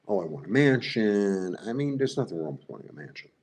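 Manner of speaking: ordinary delivery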